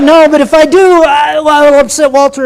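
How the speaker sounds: loud, high mock anguish; agonized inner debate. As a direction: act out being torn over a decision. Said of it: "and I thought".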